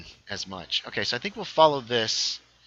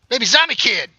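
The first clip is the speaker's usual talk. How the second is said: high, loud alarmed cry; alarmed shout; 11 semitones higher, 10 dB louder than usual. Real, not acted. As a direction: shout the warning at the zombie. Spots baby zombie in game.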